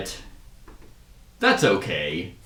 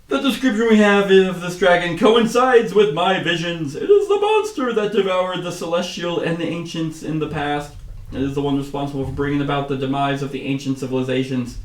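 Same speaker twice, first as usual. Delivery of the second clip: higher, projected voice easing down; solemn keeper; 6 semitones higher than usual. Acted. Keeper telling the council his visions, high declaiming voice.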